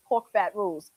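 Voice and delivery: Emerald voice. Pretend. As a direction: read the comment as Emerald, low. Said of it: "in my Emerald voice".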